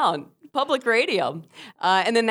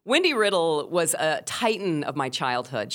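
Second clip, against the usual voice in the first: plain reading voice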